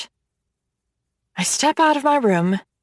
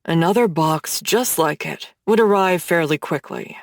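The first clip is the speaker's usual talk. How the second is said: Hades, low voice; 6 semitones lower than usual. Acted. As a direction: tease her, low and confident like a dark god.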